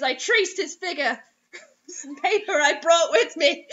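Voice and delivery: normal talking voice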